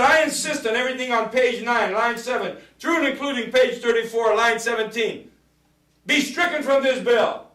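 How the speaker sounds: high, insistent floor speech; re-enacted insistent demand. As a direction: press the objection high up in the voice.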